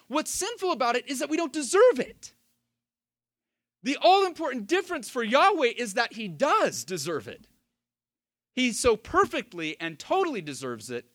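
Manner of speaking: high, insistent sermon delivery; emphatic preaching. Real, not acted. Preacher contrasting us with Yahweh, emphatic and high.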